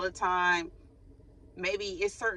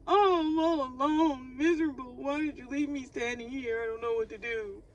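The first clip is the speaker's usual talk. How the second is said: hammed-up sobbing whine, high; mock crying; 7 semitones higher than usual. Mocking the abandoned partner's self-pity, in a hammed-up sob.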